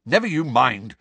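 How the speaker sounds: loud, brusque brush-off; rough. A narrator's reading. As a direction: shut the question down roughly. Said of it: "said Harry roughly".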